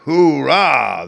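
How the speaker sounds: loud, high drawn-out cheer; crowd cheer. A narrator's reading. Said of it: "they all raised a hoarse cheer".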